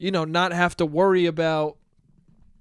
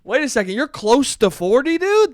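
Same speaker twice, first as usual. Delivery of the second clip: higher and louder, hyped; impressed bro; 7 semitones higher, 6 dB louder than usual. Retold or imitated. Mocking himself meeting an older person, hyped. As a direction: gush like a bro impressed by age.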